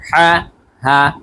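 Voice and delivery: normal talking voice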